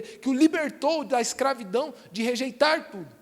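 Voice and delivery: normal talking voice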